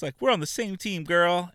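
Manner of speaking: put-on catty mean-girl sneer, high pitched; snotty mean girl. Mocking Stephanie's taunt.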